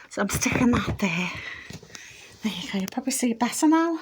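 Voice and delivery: breathless, panting after the stairs; winded, half whispered; out of breath. Real, not acted. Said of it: "Oh, I'm out of breath".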